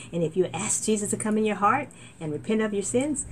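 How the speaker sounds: ordinary talk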